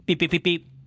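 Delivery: quick mechanical beeping; imitated phone beeps. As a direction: mimic a dropped-call tone.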